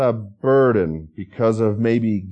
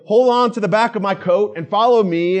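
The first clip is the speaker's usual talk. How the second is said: higher, urgent order to grab the coat; firm command; 7 semitones higher, 3 dB louder than usual. Retold or imitated. Firefighter guiding someone out of a fire, urgent command. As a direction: lead someone out of a burning room, firmly. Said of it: "They say".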